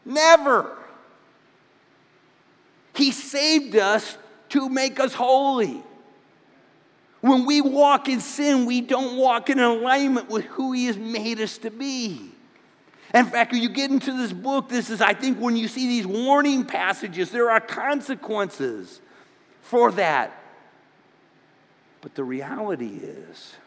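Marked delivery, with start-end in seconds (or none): 0.0-0.8 loud high shouted refusal; God forbid, no, Never shouted out; yell. Retold or imitated. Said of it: "I would just yell out". 2.9-20.4 loud, high pulpit voice; full-force preaching. Real, not acted.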